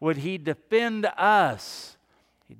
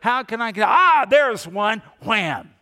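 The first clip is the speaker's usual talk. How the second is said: gleeful pouncing; 5 semitones higher, 9 dB louder than usual. Acted. Preacher mimics someone hunting people to condemn, gleeful pouncing. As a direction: pounce eagerly on a caught culprit. Acting out an eager condemner spotting prey.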